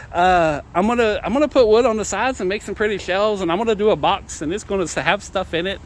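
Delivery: ordinary talk, nothing marked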